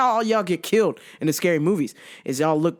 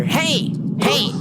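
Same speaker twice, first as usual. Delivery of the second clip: pitched-up, insistent heys; half-yelled pestering hey; mock yelling; 7 semitones higher than usual. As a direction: imitate a customer calling out. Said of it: "this guy was just yelling".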